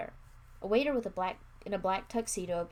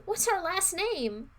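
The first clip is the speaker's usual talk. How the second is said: higher, slow, urgent demand; 12 semitones higher, 5 dB louder, much slower than usual. A narrator's reading. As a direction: plead hard for an answer. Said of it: "I demanded".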